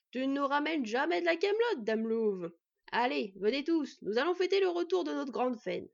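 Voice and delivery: loud, booming good cheer; rally everyone with a hearty shout; jovial smith. A narrator's reading. Smith welcoming Fenn back to celebrate, jovial.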